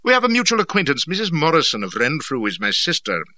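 clipped, businesslike officer voice; brisk military gentleman. Acted. Brisk Abercrombie speaks.

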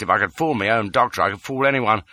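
usual speaking voice

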